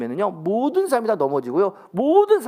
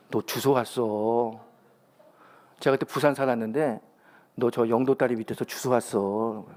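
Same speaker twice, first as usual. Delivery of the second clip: neighbor's quiet tease; 6 semitones lower, 4 dB quieter than usual. Retold or imitated. Neighbor teasing child quietly.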